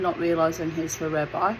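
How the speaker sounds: usual speaking voice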